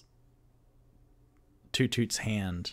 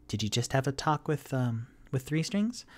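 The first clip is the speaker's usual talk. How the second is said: Bonnie's voice; 4 semitones higher than usual. Acted. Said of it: "looks up and goes".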